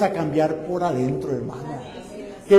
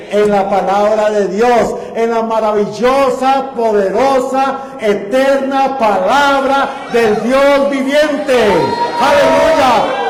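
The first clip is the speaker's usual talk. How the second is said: loud, slow, higher proclamation of the word; full-force preaching; 4 semitones higher, 6 dB louder than usual. Acted. Pastor exalting the word of God to the church, booming preaching. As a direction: thunder it out like a revival preacher.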